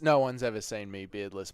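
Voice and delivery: normal talking voice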